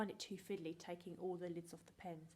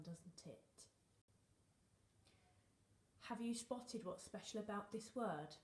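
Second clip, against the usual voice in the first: ordinary delivery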